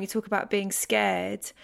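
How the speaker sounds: normal talking voice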